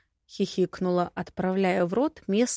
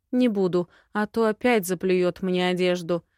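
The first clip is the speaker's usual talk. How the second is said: usual speaking voice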